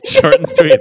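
laughing. Real, not acted. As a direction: squeal it through giggles. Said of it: "I love that so much".

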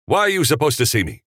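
fast, high-pitched impatient snap; snapped. A narrator's reading. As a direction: fire the question off irritably. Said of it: "Capra snapped".

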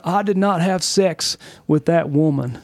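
mock presidential Southern voice; half-serious impersonation of a Southern politician; Bill Clinton. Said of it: "did a Bill Clinton impersonation".

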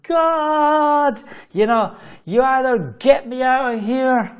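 high, sustained megaphone-style call; amplified shout. Retold or imitated. Man pleading with God from inside a tank, shouting. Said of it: "the biggest megaphone you've ever seen".

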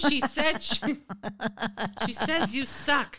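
high, loud mocking tone; playful high tease with a laugh; teasing high voice. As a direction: rib your friend about his mom. Teasing mom message, high voice.

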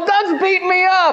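high, put-on little-kid wail; boy calling mom, pretending his brother hit him; fake-hurt whine. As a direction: ham up the pain to get brother in trouble. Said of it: "I go".

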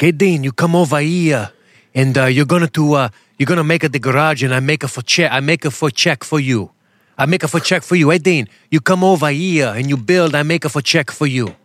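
imitating an immigrant mechanic's broken-English Portuguese accent; thick mock Portuguese accent, lively and sing-song. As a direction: haggle like a Portuguese handyman, accent laid on thick. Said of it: "That's how he talks".

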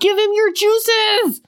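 high, slow, drawn-out mock cry; hammed-up command. Co-host joining in as the heroine, hammed-up cry. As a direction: stretch out the comic order.

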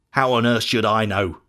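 a touch of defensive anger; understated sullen snap from a guilty brother; angry retort. A narrator's reading. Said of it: "replied Cain angrily".

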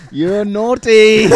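high, loud, drawn-out mock telling-off; mock scolding shout. Guest teases hosts after the fish joke, mock scolding shout.

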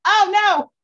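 high, loud panicked squeal breaking into a laugh; playfully alarmed cry, laughing at herself; mock panic. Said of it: "I'm like".

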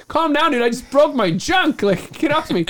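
high, loud mock protest, laughing at the end; exasperated yell. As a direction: yell back, hurt and fed up, half laughing. Retold protest at ski patrol.

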